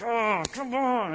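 high, drawn-out mock exasperation; exasperated outburst. Acting out imagined angry confrontation.

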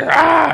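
a sudden loud roar imitating the angry client; mock roar. Pastor imitating the cursing client with a loud roar. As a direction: burst out with a big roar. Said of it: "it was like".

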